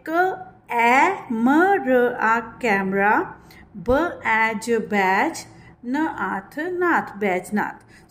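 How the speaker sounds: slow letter-by-letter sounding; slow spelling out. Shown as a demonstration. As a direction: sound out each syllable for the class.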